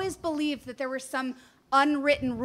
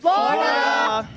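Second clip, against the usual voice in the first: calling out; 5 dB louder, slower than usual. Real, not acted. Calling out home state.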